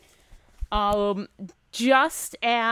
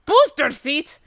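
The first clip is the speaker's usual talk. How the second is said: loud, higher dwarf voice, offended; indignant gruff dwarf; 7 semitones higher, 9 dB louder than usual. Acted. Dwarf answers taunt in character.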